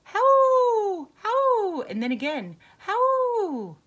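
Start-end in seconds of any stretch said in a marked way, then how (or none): 0.0-1.8 loud, high answering howls; wolf howls. A narrator's reading. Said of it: "something howled back". 2.8-3.8 loud, high answering howls; wolf howls. A narrator's reading. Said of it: "something howled back".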